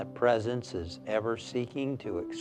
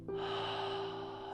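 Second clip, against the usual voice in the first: long, soft audible breath; one slow breath to lead the group in relaxing; deep cleansing breath; 9 dB quieter than usual. Shown as a demonstration.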